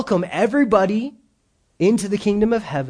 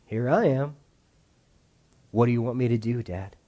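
soft earnest son; 7 semitones lower, 8 dB quieter than usual. Retold or imitated. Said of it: "Joseph answers father".

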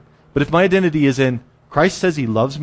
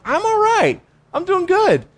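much higher put-on falsetto, pretending all is fine; mock cheery denial; slower than usual. Preacher mimics people pretending they're fine, high falsetto. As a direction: squeak out a forced I'm-fine.

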